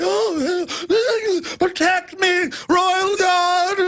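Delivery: high, hammed-up effeminate cry for help; mock Charles voice. Host mocking Charles calling his guard, high mock squeal. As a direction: squeal for the guard like a frightened prince. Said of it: "Charles looked even more effeminate ... just going".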